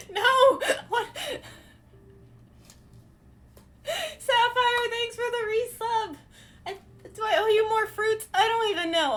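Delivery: high, loud squealing disbelief; overwhelmed squeal. Real, not acted. Overwhelmed by a resub.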